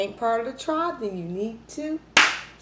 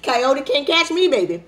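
laughing boast; 6 semitones higher, 8 dB louder than usual. Real, not acted. Vlogger bragging she's the road runner, laughing loudly. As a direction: crow with laughter like you can't be caught.

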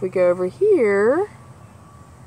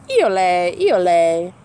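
wood thrush song; 3 semitones lower, 5 dB louder, slower than usual. Acted. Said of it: "Wood thrush turns the twilight still".

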